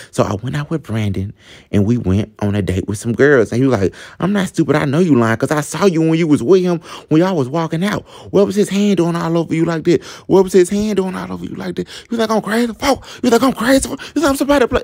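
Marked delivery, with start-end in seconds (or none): 4.2-14.8 high-pitched mock rant, climbing higher; heated, repetitive accusations in a raised pitch; imitating angry yelling. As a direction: act out a jealous boyfriend going off. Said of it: "yelling and yelling".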